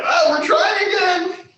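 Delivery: high, loud, slow mocking shout; mock weary shout. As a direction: whine it high and loud, drawn out. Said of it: "Everyone else be like".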